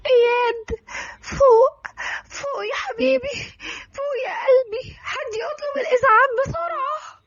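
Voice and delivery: high, tearful pleading; wailing. Acted. Darin begs the shot Iyad to wake, wailing. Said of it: "صوت سويت دارين ملى المكان".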